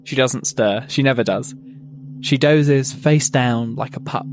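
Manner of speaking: usual speaking voice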